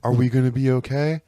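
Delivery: put-on meek, worried child voice with rising end; mock a kid after getting yelled at; scared kid. Becky Lynch like a scolded ten-year-old, timid. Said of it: "a fucking ten-year-old who got yelled at".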